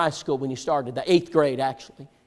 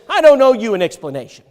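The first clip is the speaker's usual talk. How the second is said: louder, higher, cold refusal; retold curt anger; 6 semitones higher, 7 dB louder than usual. Father refusing the boy, retold curt anger. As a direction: slam the door shut with the words. Angry father shuts the Bible.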